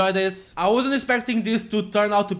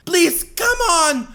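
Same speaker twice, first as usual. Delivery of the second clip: high, loud urging of failing magic; will the spell to work; desperate young girl; 11 semitones higher, 5 dB louder, slower than usual. Acted. Yelanite girl pleading with her healing magic, desperate.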